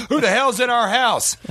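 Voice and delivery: sudden high-pitched outraged cry; gasp, then challenge the pretend intruder; mock alarm. Acts alarm at staged intruder.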